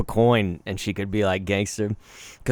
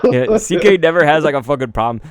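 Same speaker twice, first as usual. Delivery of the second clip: normal talking voice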